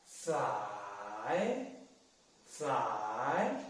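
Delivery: "zai" dipping very low, then rising; drawn-out low scoop on each "zai"; third tone. Shown as a demonstration. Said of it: "third tone".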